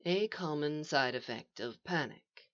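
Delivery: quiet, clinical calm; soft. A narrator's reading. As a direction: explain it very softly. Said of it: "said the voice softly".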